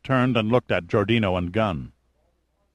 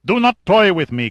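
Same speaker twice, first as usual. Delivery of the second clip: venomous, higher and louder; viperous; 10 semitones higher, 7 dB louder than usual. Acted. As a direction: hiss a warning with menace. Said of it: "his tone suddenly viperous".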